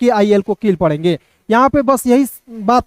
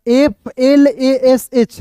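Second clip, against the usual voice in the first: letters spelled out slowly, one by one; slow letter spelling; 2 semitones higher, much slower than usual. Shown as a demonstration. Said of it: "तो इसे पढ़ेंगे flash". (धीरे-धीरे अक्षर गिनाना)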